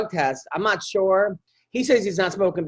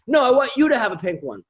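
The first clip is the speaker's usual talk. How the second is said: lightly voiced demanding daughter, higher pitch; a hint of a toddler insisting; insistent child; 6 semitones higher than usual. Daughter insisting dad get the pink bubbles, lightly demanding.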